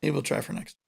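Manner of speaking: soft whispered joke; a whispered throwaway line; whispered aside. Real, not acted. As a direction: drop to a breathy whisper for the joke. Quiet joke aside to co-host.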